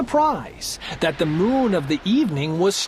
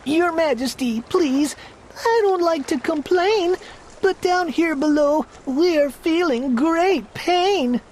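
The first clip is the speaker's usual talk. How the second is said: groaning little turtle; 7 semitones higher than usual. Acted. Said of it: "came a groan".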